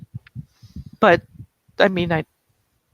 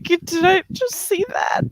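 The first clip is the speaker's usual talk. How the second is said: much higher, incredulous question; can't believe her eyes; stunned disbelief; 12 semitones higher than usual. Acted. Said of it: "She looks to Jack like".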